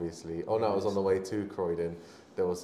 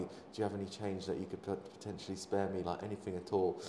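soft, imitated humble plea; very quiet begging; 8 dB quieter than usual. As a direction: ask discreetly, as if only to one small group. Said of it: "speaking very quietly".

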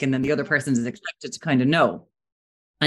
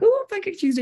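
imitating his excitement, pitched much higher; excited. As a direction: mimic boyfriend all excited. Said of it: "He was all excited".

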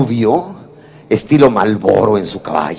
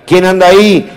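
loud call from upstairs, a touch higher; husband shouting; 6 semitones higher, 7 dB louder than usual. Retold or imitated. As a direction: shout down the stairs who's there. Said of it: "el marido grita". (marido gritando desde arriba)

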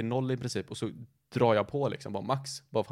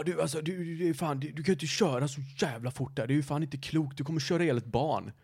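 angry neighbour; 4 semitones higher than usual. Retold or imitated. Neighbour voiced yelling about speeding.

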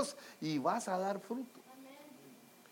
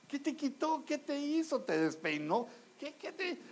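higher and softer, light mocking questions; teasing coaxing questions; 8 semitones higher, 5 dB quieter than usual. Pastor teasing the rejected young man, light mocking. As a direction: shrug it off with playful questions.

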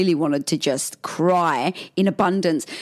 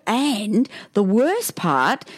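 ordinary delivery

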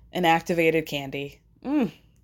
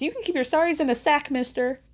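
high, quick quoted outburst; pitched-up, hurried mock indignation; George Costanza; 8 semitones higher than usual. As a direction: lightly imitate a sitcom character's retort. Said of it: "It's George Costanza".

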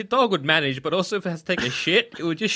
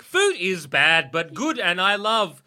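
slow dog babble; slower than usual. Pretend. Garbled dog speech.

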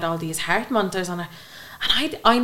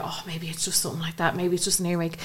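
whispered to herself, softer; hopeful whisper; 5 dB quieter than usual. Retold or imitated. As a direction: whisper the hope under your breath. Hoping it's only an earache.

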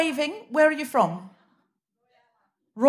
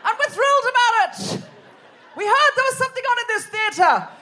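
high-pitched mock crowd shout; hammed-up glee, calling out high; clueless thrilled audience; 13 semitones higher than usual. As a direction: imitate an overexcited audience. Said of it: "half the audience like".

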